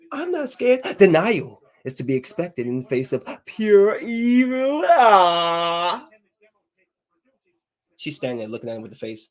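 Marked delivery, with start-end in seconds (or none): 0.1-0.8 Cindy Lou voice. Retold or imitated. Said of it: "She says". 1.0-6.1 high, slow menacing Grinch; hammed-up Grinch. Streamer quotes the Grinch gloating at Cindy Lou, high and slow.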